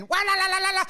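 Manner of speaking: high sing-song wailing; mock wailing la la. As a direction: wail it like a cartoon wife. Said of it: "and then".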